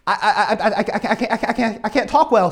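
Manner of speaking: stammering on the first words; mock stutter. Preacher as Moses making excuses to God, imitated stutter. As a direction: stutter repeatedly, then get the sentence out. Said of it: "I just started to stutter".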